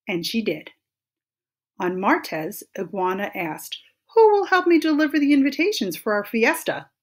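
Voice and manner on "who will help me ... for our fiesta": high, loud, quick request; story character rallying helpers, high and quick; eager appeal. A narrator's reading. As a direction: call out brightly for volunteers. Iguana appeals to her friends for help.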